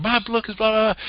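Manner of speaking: high-pitched, eager mock boasting; imitating excited author. Conference attendee announcing their book to the speaker, imitated high excitement. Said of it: "they were all excited".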